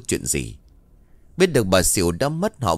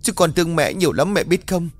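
hammed-up mourning cry; fake wailing; 6 semitones higher than usual. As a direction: wail for show so neighbours hear. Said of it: "đổi qua một giọng khác mà gào khóc".

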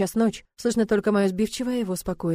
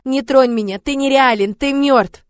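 loud, raised cries of defiance; desperate shouting; 5 semitones higher, 9 dB louder, slower than usual. A narrator's reading. Captive girl denies her attacker is real, shouting. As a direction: shout each phrase to drive him away. Nightmare victim fights off attacker.

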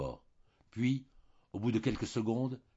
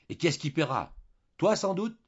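higher, louder indignant questions; angry interruption; 9 semitones higher, 6 dB louder than usual. A narrator's reading. Julien cuts his wife short over who pays, angry. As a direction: cut in sharply, pitch climbing on the challenge.

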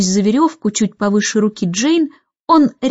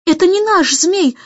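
rising, higher alarmed cry; a girl crying out that something's wrong; alarmed exclaim; 5 semitones higher than usual. A narrator's reading. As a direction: gasp it out as the kite changes. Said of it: "воскликнула она".

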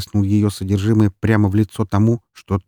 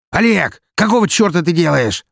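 angry shout; 9 semitones higher, 4 dB louder than usual. Acted. Partner yells at frozen Oleg.

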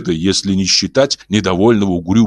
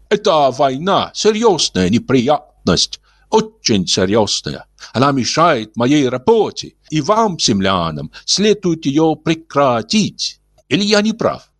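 high, slow, polite Martian; gentle alien voice; 8 semitones higher, slower than usual. Acted. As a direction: speak like a kindly old alien.

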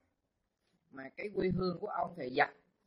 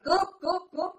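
much higher, much louder cry of alarm; shouting thief; 13 semitones higher, 10 dB louder than usual. Retold or imitated. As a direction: yell it out to call the neighbours running. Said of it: "Ông la lên". (hét lớn kêu cướp, giọng cao vút)

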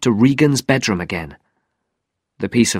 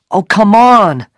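raised, louder exasperated plea; 10 semitones higher, 7 dB louder than usual. Acted. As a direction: urge it on, out of patience. Regan impatient with the unresponsive metal.

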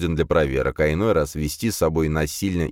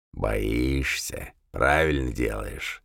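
low, soft, slow rumbling fiend; rumbling demon voice; 7 semitones lower, 5 dB quieter, much slower than usual. A narrator's reading. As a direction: rumble it out like a beast. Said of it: "пророкотал он".

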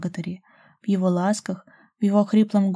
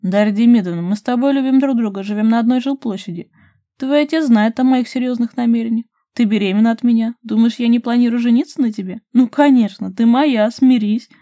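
loud, emphatic, raised-pitch insistence; snorting; 4 semitones higher, 9 dB louder than usual. A narrator's reading. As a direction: lay it out forcefully, like a closed argument. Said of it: "фыркнул Руслан". (громко, с напором)

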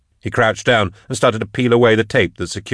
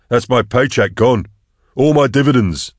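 higher-pitched, bitter grumbling; bitter complaint; 5 semitones higher, 4 dB louder than usual. A narrator's reading. Hunter lamenting his lost pay, bitter complaint. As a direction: complain over the loss, pitch climbing.

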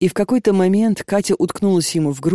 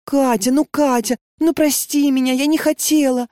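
high, pleading apology; pleading excuse; 8 semitones higher than usual. A narrator's reading. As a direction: beg forgiveness, whining a little. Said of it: "оправдывалась Леночка".

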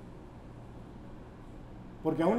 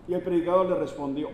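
ordinary delivery

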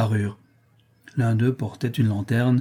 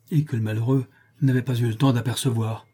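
plain reading voice

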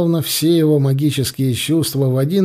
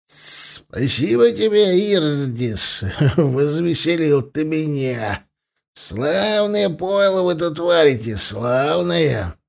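slightly slurred, merry dwarf; thick-tongued after a strong drink; tipsy slurring; 3 semitones higher, slower than usual. Acted. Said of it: "слегка заплетающимся языком объявил Сугутор".

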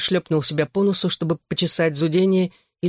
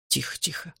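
much softer, soothing hush; 10 dB quieter than usual. A narrator's reading. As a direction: hush him gently, voice dropped. Father hushes his drugged son. (тихо, успокаивающе)

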